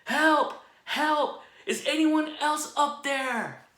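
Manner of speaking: high, loud yell for other help; dangling man yelling for anyone else; panicked yell. Acted. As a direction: shout past God in panic. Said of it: "Finally, Jack yelled".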